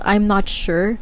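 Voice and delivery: ordinary delivery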